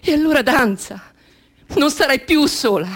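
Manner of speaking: high, loud, ecstatic call; exalted cry. Acted. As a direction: urge her on joyfully. Exalted invitation. (invito esaltato, alto e forte)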